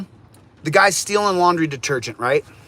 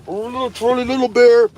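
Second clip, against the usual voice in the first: much higher pet-talk voice; cooing to the dog; 8 semitones higher, 4 dB louder than usual. Real, not acted. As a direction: gush over the little dog.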